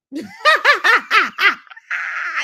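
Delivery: big high burst of laughter; loud delighted laugh. Real, not acted. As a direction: laugh out loud at your own brag. Laughing at her own boast.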